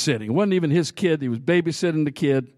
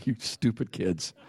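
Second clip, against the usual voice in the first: put-on God sighing at kids; 6 semitones lower, 8 dB quieter than usual. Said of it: "I wonder if God just like".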